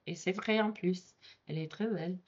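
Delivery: soft French aside. Acted. French practice example aside.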